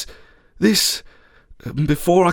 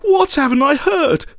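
high, desperate question; anguished, high-pitched plea; pleading; 10 semitones higher than usual. A narrator's reading. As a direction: beg to be told, voice pitched up. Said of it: "I pleaded".

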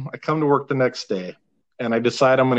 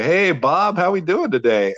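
bright, higher friendly hello to a member; cheery greeting; 6 semitones higher than usual. Retold or imitated. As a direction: greet him like an old pal.

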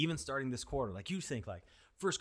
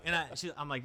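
ordinary delivery

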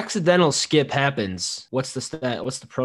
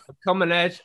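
higher retry at British, breaking into laughter; failed British accent; 7 semitones higher, faster than usual. Acted. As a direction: attempt British and give up laughing. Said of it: "I can't do the British accent".

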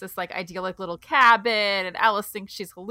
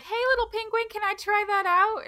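coaxing sweet voice; 12 semitones higher than usual. Retold or imitated. Heroine coaxing penguin in story.

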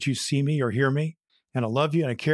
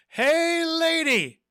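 much higher, drawn-out parrot voice, louder; squawky parrot; 3 dB louder, much slower than usual. Acted. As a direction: play a rude talking parrot. Parrot calls out.

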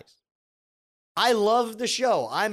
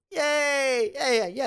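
mock cheer; 8 semitones higher, 3 dB louder, slower than usual.

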